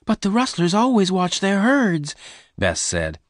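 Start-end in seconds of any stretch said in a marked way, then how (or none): 0.0-2.1 high-pitched, upset protest; angry. A narrator's reading. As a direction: protest high, scolding him for the risk. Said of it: "She was angry".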